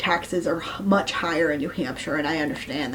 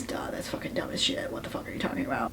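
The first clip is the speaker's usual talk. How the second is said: hushed incredulous whisper; disbelieving whisper; 11 dB quieter, faster than usual. Acted. To the viewers about the latte order, a disbelieving whisper.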